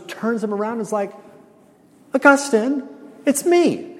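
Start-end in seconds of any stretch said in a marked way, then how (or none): 2.1-4.0 put-on high, pleading call of an old mistress; hammed-up mistress voice. Preacher plays the old mistress grabbing Augustine, high hammed-up call. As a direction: act out the woman catching his shoulders. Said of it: "You like this acting job I'm doing?".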